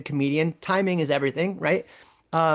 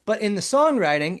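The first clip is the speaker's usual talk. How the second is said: normal talking voice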